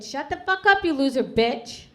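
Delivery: high, very loud shout, slowing at the end; furious yelling. Retold or imitated. Mother blowing up at daughter in waiting room, furious yelling. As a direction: explode in an acted yell. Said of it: "she whispered and I was yelling".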